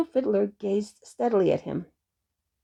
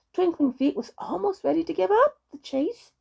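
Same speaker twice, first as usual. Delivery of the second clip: plain reading voice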